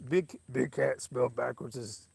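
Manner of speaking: light Harry Carey voice; a hint of Harry Carey; Harry Carey impression. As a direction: try again as the Cubs announcer. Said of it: "How would Harry Carey say whose name?".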